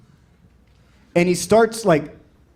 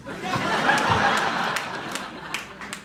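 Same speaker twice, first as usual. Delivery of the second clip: laugh; 5 dB quieter than usual. Real, not acted. Laughing at own punchline.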